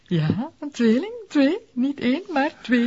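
hoger, stralend trots; opgetogen. Acted. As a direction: juich het nieuws uit, hoger en luider. Onthult opgetogen een tweeling. (opgetogen onthulling aan haar man)

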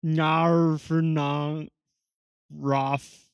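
slow reversed-sounding syllables; backwards speech. Shown as a demonstration. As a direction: mimic reversed audio. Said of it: "say that backwards".

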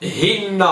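هن said as "hinnaa", with an i and doubled n; i vowel, doubled n. Shown as a demonstration. Teacher showing what we don't say, هن as "hinnaa". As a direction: say هن as "HIN-naa". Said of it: "لم نقل".

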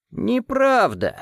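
high, slow rasping croak; hoarse croak. Acted. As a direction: croak the word out slowly. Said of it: "прохрипел голос".

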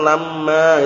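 "lamma" with a long doubled m; "lamma" drawn out on the doubled m; with tashdid. Shown as a demonstration. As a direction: hold the mm in "lamma". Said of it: "at-tasdidnya kan".

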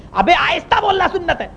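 high, exasperated retort; imitating irate imam. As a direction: insist on the rule in a high voice.

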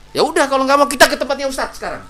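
high, loud, hot-headed ultimatum; acted angry outburst. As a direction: throw down a challenge mid-quarrel. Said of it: "janganlah kita angkat suara tinggi-tinggi".

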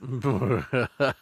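ordinary talk, nothing marked

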